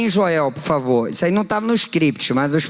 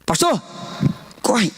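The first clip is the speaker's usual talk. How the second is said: retold shout; 5 semitones higher, slower than usual. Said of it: "Aí eu gritei".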